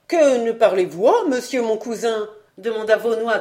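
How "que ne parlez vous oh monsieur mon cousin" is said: loud, swaggering challenge; cheeky, emphatic dare; brazen effrontery. A narrator's reading. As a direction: brazen it out, swooping up on the "oh". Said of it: "qui fit appel à toute son effronterie".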